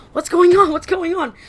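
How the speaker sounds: high, urgent questions, first one loud; mock commotion; alarmed onlookers. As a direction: voice a startled crowd rushing over. Alarmed classmates rush out.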